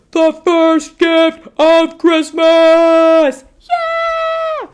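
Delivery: very high, loud, slow shout; loud hammed-up announcement; booming proclamation. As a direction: proclaim it like a triumphant Santa.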